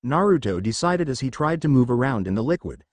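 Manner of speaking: ordinary delivery, nothing marked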